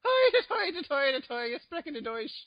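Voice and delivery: high, manic mock-German babble; scrambled German accent. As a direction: let the Canadian slide into German. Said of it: "insanity scrambled into one".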